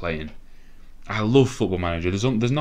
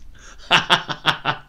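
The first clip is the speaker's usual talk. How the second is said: breathless giddy laughter; 8 semitones higher, 3 dB louder than usual. Real, not acted. Laughing after the save.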